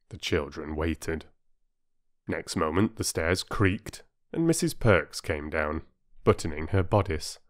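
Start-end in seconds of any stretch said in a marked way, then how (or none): none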